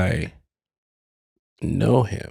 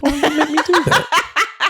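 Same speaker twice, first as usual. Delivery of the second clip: laughing mock protest; embarrassed, laughing plea to Elizabeth; high laughing whine; 14 semitones higher than usual. Real, not acted. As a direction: whine it through a laugh, pitched way up. Mock complaint to letter writer.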